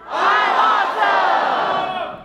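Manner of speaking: high, loud, drawn-out I'm awesome; crowd yell. Shown as a demonstration. Leading the whole crowd in a confidence chant, drawn-out shout. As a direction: stretch it into a huge rallying shout. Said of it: "to yell".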